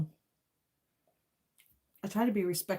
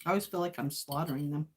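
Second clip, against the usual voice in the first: normal talking voice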